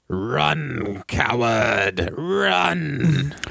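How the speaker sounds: long drawn-out words, like an echo from the void; slow, otherworldly taunt; slow echoing villain. Acted. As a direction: stretch each word into a slow, eerie call. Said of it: "you hear slowly this".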